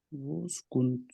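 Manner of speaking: whispered guess to himself; unsure whisper. Real, not acted. Student unsure of the answer. (غیر یقینی سرگوشی میں اندازہ)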